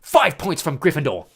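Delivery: loud, curt penalty; terse. A narrator's reading. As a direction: pronounce the punishment sternly and loudly. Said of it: "Percy said tersely".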